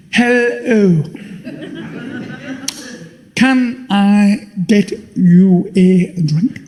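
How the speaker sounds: slow, refined, putting on airs; a lightly voiced gent, slow and careful; posh new voice. David, now rich, chatting up the woman, slow and posh.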